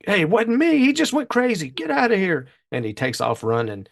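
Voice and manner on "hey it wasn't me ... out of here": hoodlum protesting. Retold or imitated. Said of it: "the hoodlum's like".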